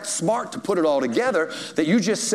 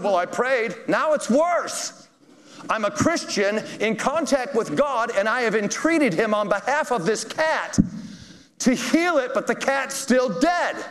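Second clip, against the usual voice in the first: exasperated cat owner; 8 semitones higher than usual. Retold or imitated. Cat owner complaining to pastor.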